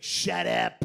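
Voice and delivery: loud low bark of a big cartoon dog; gruff big dog. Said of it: "the big dog's like".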